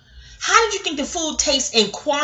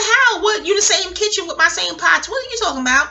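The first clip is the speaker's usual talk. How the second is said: retold incredulity; 8 semitones higher than usual. Friend arguing about same kitchen and pots, incredulous. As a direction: fire back baffled questions, imitating a friend.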